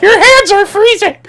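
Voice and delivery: mock choked squeal. Choked guy in a retold ad squealing. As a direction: squeak it out while being choked. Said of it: "And starts choking".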